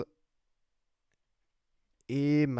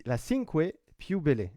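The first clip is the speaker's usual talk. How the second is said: put-on Italian accent; 3 semitones higher, 3 dB louder than usual. Apologising for his Italian accent while naming the insert, in a light Italian accent. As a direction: say Cinque Più Belle with a gentle Italian accent, ending each word on an e. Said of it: "Vous m'excuserez d'avance de mon accent italien".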